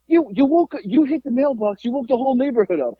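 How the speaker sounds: high mock scolding; imitated angry dad. Father blaming son for waking the neighborhood, high mock anger. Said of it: "he was so mad at me".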